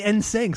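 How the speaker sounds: mock the way "N sync" came out; mimicked mispronunciation. Challenging cohost on the band name, mimicking. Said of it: "Why do you say".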